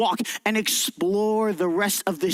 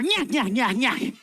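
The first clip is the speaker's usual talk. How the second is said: a bit louder nya nya cackle; louder witch cackle; 3 semitones higher than usual. Shown as a demonstration. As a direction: let the witch cackle grow. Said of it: "Make it just a little bit louder".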